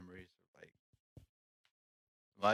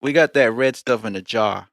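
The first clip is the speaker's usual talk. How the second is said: put-on Texan accent; 5 dB louder than usual. Bragging about jarred salsa, loud Texan accent. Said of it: "practicing my Texan accent".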